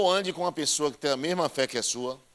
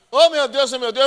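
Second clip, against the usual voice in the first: high, loud hammed-up wail, a fake swoon; mock wailing mourner; 10 semitones higher, 9 dB louder than usual. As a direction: play the fainting mourner for laughs. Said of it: "eu fazia assim".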